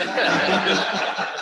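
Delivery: loud, high laugh; real burst of laughter. Real, not acted. The teller breaks up laughing at Sam's putdown of Dad. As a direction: laugh hard at the punchline.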